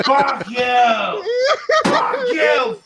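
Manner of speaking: loud raging yell at the object; furious shout. Real, not acted. Man yelling at his broken cigarette, furious shout. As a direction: scream it out in full fury.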